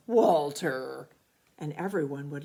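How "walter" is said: louder accusing name; blaming. A narrator's reading. Uncle Irv blaming Walter for his own fart, accusing. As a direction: pin it on the dog.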